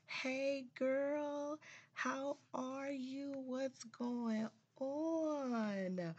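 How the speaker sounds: put-on high, slow, drawn-out hey girl; high girly greeting. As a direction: sing it out like greeting a friend. High playful greeting to listeners.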